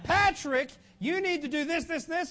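high-pitched imitation of mom's orders; nagging mother. His own mother giving orders.

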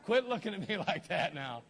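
loud playful telling-off through laughter; laughing mock scolding. Real, not acted. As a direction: scold the audience loudly while cracking up. Joking scolding of the congregation.

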